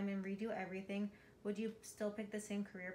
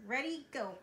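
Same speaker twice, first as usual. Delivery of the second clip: ordinary delivery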